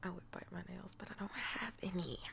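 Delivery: soft whispered confession. Real, not acted. Streamer whispering a joke to chat. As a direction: breathe it out in a whisper.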